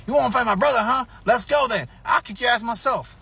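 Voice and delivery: put-on high, loud kid's challenge; taunting little brother. Bully's little brother challenging the narrator, high loud taunt. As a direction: bark the dare like a cocky kid brother.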